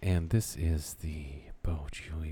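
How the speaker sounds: low, soft radio host; public radio voice. Pretend. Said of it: "public radio voice".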